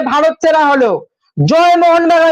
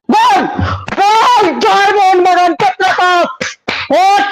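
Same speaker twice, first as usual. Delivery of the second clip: goal celebration shout; 5 semitones higher than usual. Real, not acted. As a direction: roar it like the winner just went in. Live goal for his team. (উচ্চস্বরে গোলের উল্লাস)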